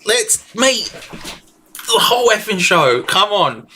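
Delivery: high, slow hyped chant of a name; hype call. Real, not acted. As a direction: drag out the name like a ring announcer. Hyping a wrestler in chat.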